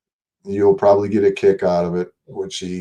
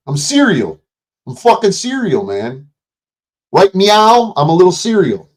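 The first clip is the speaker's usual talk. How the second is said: goofy mock serious; 10 semitones higher, 6 dB louder than usual. Joking "serious" as "serial.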